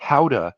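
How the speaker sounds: "Gouda" said loudly as HOW-da; Dutch pronunciation. Shown as a demonstration. His habit from Dutch family heritage, Dutch pronunciation. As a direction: say "Gouda" with "ow", no hard G. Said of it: "I've gotten into a habit of calling it".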